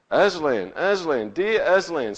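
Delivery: Lucy sobbing his name as she runs to him. Acted. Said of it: "sobbed Lucy".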